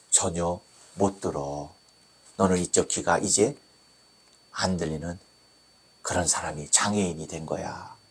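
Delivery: low, hushed verdict in halting phrases; acted doctor, subdued and deep-pitched; grave doctor. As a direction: break the bad news softly, with pauses. Doctor confirming the deafness.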